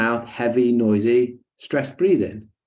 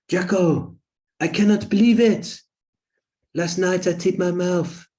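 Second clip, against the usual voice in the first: higher, lightly hammed French-accented client; French accent impression; 7 semitones higher than usual. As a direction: play the excited French client, French accent and all. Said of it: "an impersonation".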